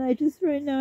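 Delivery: ordinary talk, nothing marked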